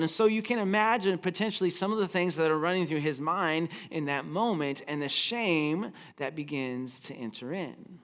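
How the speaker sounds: ordinary delivery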